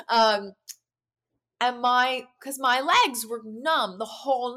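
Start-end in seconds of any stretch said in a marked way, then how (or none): none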